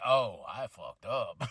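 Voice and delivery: low, slow, sheepish mutter of regret; a hint of a beaten wrestler realising his blunder; deflated Damian. Vlogger voicing Damian after a botched cash-in, low and slow. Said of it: "Damian's like".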